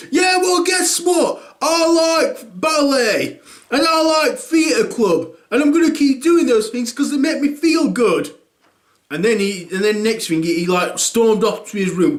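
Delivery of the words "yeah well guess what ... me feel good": put-on high defiant teen; stroppy kid voice; defiant son voice.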